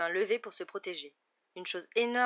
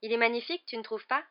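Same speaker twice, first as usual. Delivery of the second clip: higher, delighted lilt; beaming delight; 5 semitones higher, 5 dB louder than usual. A narrator's reading. Girl showing friends her new cat, beaming. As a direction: proudly show off and invite agreement. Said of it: "dit Hermione rayonnante".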